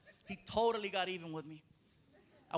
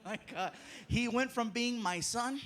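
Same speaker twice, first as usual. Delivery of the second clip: ordinary talk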